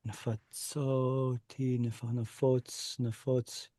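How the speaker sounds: nafotz muttered slowly and softly, stress on "fotz"; quiet sounding out. Shown as a demonstration. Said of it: "that's why we're getting the O".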